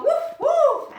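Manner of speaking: high, loud woof woof; barking dog. A narrator's reading. Narrator barks as the dog. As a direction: bark like an eager dog.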